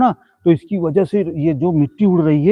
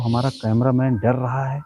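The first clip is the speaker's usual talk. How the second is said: ordinary talk, nothing marked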